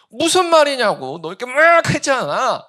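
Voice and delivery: loud, high indignant comeback; exasperated retort. Retold or imitated. Person insisting the other one clearly got angry, loud and indignant. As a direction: throw the accusation back, loudly. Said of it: "자기 화를 안 냈대요".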